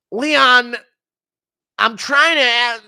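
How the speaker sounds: high, loud, slow interviewer voice; Joe Rogan interviewer. Retold or imitated. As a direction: try to get a word in over the shouting. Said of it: "Impression of Leon asking for a title shot".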